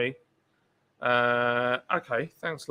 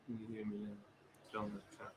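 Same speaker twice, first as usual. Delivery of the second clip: faint and far off; distant mic; 5 semitones lower than usual. Real, not acted. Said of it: "John is distant".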